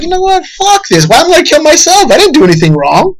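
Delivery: high, strained Joker turning on his idol; Joker snapping. Retold or imitated. Joker deciding to kill the host, high and strained. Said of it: "he's like".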